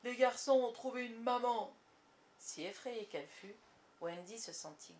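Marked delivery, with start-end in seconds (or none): 0.0-1.7 raised, anguished lament; despairing outburst. A narrator's reading. Captain revealing his woe to pirates, despairing outburst. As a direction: wail the bad news aloud. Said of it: "laissa éclater son désespoir".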